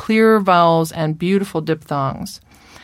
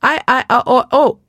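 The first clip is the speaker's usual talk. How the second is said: higher, mock child with no consonants; vowels only; 7 semitones higher, 4 dB louder than usual. Shown as a demonstration. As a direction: drop every consonant like a small child. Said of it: "leave consonants out altogether".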